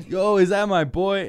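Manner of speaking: loud, high-pitched hype call; excited greeting. Acted. Indrid Cold hailing his boy, loud excited greeting. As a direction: holler happily to a buddy.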